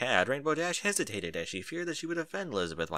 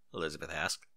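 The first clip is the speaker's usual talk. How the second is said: usual speaking voice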